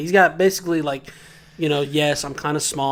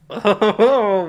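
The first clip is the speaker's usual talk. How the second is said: bursting laugh; loud laugh; 6 semitones higher, 7 dB louder than usual. Real, not acted. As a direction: let a laugh out. Laughs at teasing.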